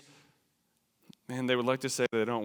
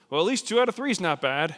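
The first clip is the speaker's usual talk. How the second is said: minimizing; 6 semitones higher, 6 dB louder than usual. Acted. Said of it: "don't minimize it".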